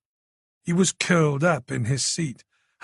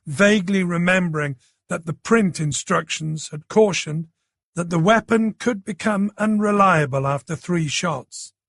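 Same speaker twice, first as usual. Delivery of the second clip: usual speaking voice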